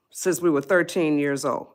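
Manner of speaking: usual speaking voice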